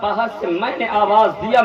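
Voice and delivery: ordinary talk, nothing marked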